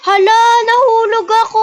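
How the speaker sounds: high, loud cry of dismay; surprised shout. Real, not acted. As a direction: yelp it as you fall. Speaker falls in game.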